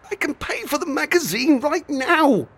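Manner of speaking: put-on a worried kid trying to talk his way out; nervous boy. A narrator's reading. Boy to the shopkeeper, nervously offering to pay. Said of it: "Zach said nervously".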